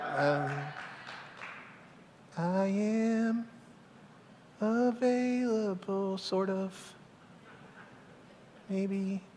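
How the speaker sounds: soft, halting, half-hearted offer; hesitant. Retold or imitated. Reluctant believer answering Jesus, hesitant and quiet. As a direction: trail along quietly, barely committing.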